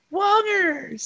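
gasp, then a very high squeaky word; silly creature catchphrase. Acted. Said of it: "That's what lactobacillus says".

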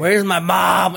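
high, loud mock child's wail; scared kid. Lost kid in a grocery store, scared shout. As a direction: cry out like a lost, frightened kid. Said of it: "scared kid in a grocery store".

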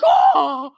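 short hammed-up "oh" scream; mock scream. Woman swept away by the current, mock scream.

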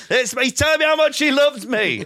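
high, loud, slow mock dad; imitating the dad meeting his son, loud and drawn out; dad's plea. Dad's voice in retold scene.

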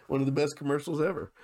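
ordinary delivery

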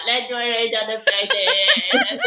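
put-on slow, laughing drunken suggestion; drunken giggle. Tipsy friend in the pub suggesting face drawing, laughing slowly. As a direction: drag it out through giggles. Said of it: "We were like".

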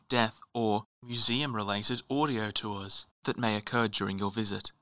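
soft, measured audio tour voice; calm recorded guide, quietly ominous; smooth museum audio guide. Acted.

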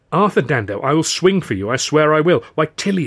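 mock-cross scolding. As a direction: scold a friend who is teasing you. Scolding him for teasing her.

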